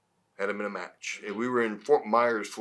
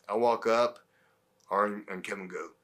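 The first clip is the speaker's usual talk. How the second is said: usual speaking voice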